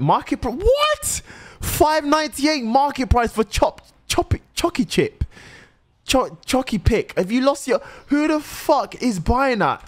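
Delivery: high-pitched, incredulous disbelief; shocked outburst. Real, not acted. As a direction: balk at an outrageous price, voice leaping up. Shocked at a market price.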